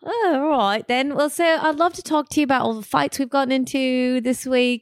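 put-on cheeky London working class accent; working class British accent. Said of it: "working class accent".

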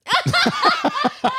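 high burst of laughter; big laugh. Real, not acted. Laughing at the braid story.

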